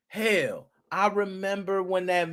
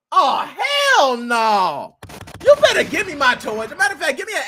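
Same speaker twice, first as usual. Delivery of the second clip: indignant demand; 9 semitones higher, 6 dB louder than usual. Real, not acted.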